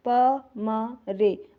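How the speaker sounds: प, म, रे drawn out one by one with gaps; separate, measured letters: प... म... रे; spelled out slowly. Shown as a demonstration. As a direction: say "प म रे" very slowly, pausing after each letter. Abbreviation repeated letter by letter.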